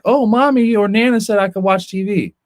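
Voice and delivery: put-on high-pitched kid making an excuse; mimicked child. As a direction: imitate a child piping up in protest. Voices a child pleading permission.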